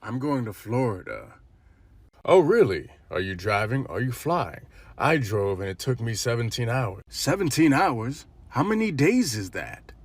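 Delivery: normal talking voice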